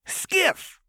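put-on high, sing-song playful girl; high chirpy menace; manic Jinx voice. A narrator's reading. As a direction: tease the hostage brightly. Jinx echoes happily.

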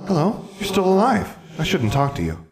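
put-on high, childlike little-girl voice; ghost girl. Ghost girl greeting Alexandra, high childlike voice. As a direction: play a small prim ghost child. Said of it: "The ghost skipped up to Alexandra and said".

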